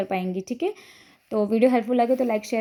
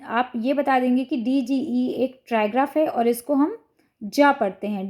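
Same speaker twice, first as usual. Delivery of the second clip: usual speaking voice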